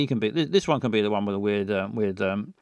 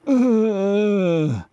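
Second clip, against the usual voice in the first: loud, high wheeze; Tom's noisy breath; 8 semitones higher, 6 dB louder than usual. Shown as a demonstration. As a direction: groan the breath in loudly. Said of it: "but goes".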